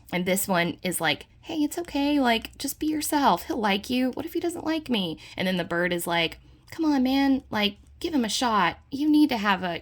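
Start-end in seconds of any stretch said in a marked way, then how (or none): 1.4-4.1 higher-pitched playful voice of encouragement; reassuring animal friend. Acted. Little animal companion encouraging a nervous dog, reassuring. 4.1-5.2 high, lightly acted fretful voice; worried dog. Dog fretting to its companion about a newcomer, mock worried. Voicing the worried dog's reply. 6.7-9.8 higher, louder playful urging; coaxing bird friend. Acted. Bird urging the old dog to accept a newcomer, coaxing.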